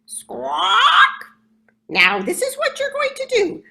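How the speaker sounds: loud, high squawking parrot call. Acted. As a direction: call them out like a bossy parrot. Parrot explaining race route.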